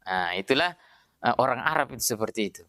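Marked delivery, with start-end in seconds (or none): none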